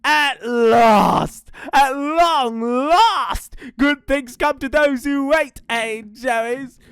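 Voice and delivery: high, loud, drawn-out gloating; satisfied dingo. Acted. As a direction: savour the victory, stretching the words.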